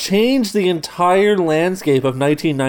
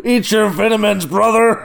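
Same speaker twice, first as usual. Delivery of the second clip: high-pitched mock wrestler exhortation; Hulk Hogan; 6 semitones higher than usual. Co-host mocking the advice, hammed-up wrestler voice. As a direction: hype it like a wrestling hero. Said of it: "Hulk Hogan Kale over here".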